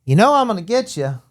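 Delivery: playful cop threat; mock threat. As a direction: taunt him like a cop. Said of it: "And he's like".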